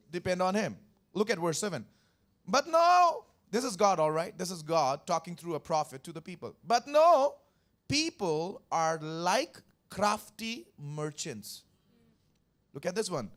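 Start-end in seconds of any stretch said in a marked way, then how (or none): 2.5-3.2 much higher, loud burst; emphatic rebuke. Acted. Preacher reading God's rebuke aloud, high and loud. 6.6-11.6 emphatic rebuke. Acted. Voicing God's rebuke in scripture.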